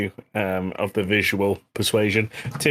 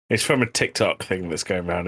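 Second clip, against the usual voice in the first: usual speaking voice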